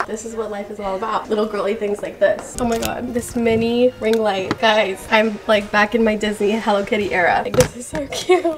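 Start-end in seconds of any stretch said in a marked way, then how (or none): none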